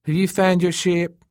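high-pitched teasing question; Elena's character voice. A narrator's reading. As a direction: ask it high, in her voice. Elena speaks in the story.

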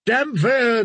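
high cry of a name; crowd cry. Acted. Drowned men crying their priest's name. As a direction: chant the name up to him. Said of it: "they cried".

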